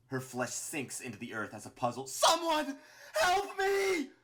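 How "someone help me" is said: very high, loud terrified wail; screaming and crying. A narrator's reading. Martlet as her face melts, screaming and crying. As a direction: scream for rescue through tears. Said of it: "screaming and crying as her face melts".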